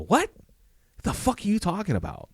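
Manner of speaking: high-pitched, indignant disbelief; incredulous outburst. Retold or imitated. Officer told he supposedly shot a lady, incredulous outburst. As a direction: throw the accusation back, voice jumping up. Retold reaction to a false accusation.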